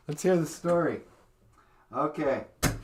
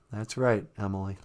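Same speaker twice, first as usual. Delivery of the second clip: much lower, softer answering voice; low puppet Michael voice; 11 semitones lower, 10 dB quieter than usual. Acted. Second puppet answering Emily in a low voice.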